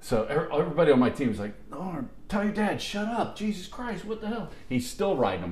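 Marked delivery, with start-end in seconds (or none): 1.7-4.5 mock exasperation. Whole team begging Norm to quiet his dad, exasperated. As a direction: imitate fed-up teammates, pitched up.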